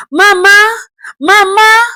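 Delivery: Mama called high and loud; calling after her. Acted. Son chasing his mother, calling high and loud. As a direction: call her back desperately.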